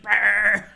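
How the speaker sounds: loud buzzing brrr; imitating Rose's fuss with a loud brrr; mock brrr noise. Rose shaking sound.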